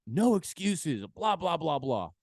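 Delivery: parodying the motivational type; mock motivator. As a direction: ham up the no-excuses coach. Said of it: "people like".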